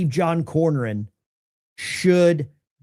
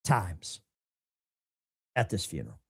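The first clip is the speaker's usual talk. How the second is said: hushed; 10 semitones lower, 12 dB quieter than usual. Real, not acted. Dropping to a hushed finish.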